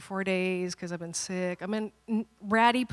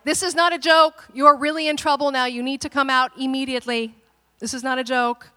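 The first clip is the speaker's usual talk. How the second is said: loud, raised-pitch stern warning; stern parental warning; 4 semitones higher, 8 dB louder than usual. Retold or imitated. Worried parents warning their hiding daughter, stern and loud. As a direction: warn a child firmly, voice raised. Parents yelling for hidden child.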